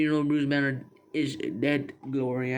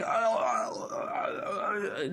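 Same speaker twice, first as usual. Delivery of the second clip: mock dying groans, raised in pitch; imitated dying noises; 5 semitones higher, 5 dB quieter, much slower than usual. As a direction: groan out repeated dying sounds. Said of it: "dying noises and all".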